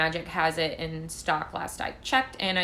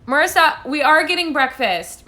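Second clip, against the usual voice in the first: higher, louder call across the house; loud, raised call to someone away from the mic; 7 semitones higher, 8 dB louder than usual. Real, not acted. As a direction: call out to your friend in the next room. Calls to someone off camera.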